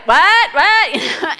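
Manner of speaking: high, loud bewildered "what"; imitating the kids' baffled what; kids' puzzled what. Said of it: "And they're like".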